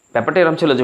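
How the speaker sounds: ordinary delivery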